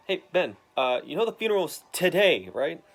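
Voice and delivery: imitating a loud pushy boss. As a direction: lean on him like a bossy editor. Jameson pushes Ben about funeral.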